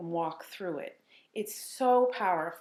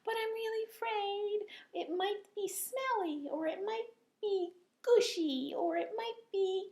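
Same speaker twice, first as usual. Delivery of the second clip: high, hesitant pretend fear; mock scared puppet; 4 dB quieter than usual. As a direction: play a puppet afraid of new food. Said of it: "And then I walk through".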